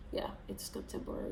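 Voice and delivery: quick, whispered, unsure; doubtful whisper. Real, not acted. Second-guessing herself to viewers, whispered and hurried. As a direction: mutter it fast to yourself. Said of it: "Why am I doubting myself?".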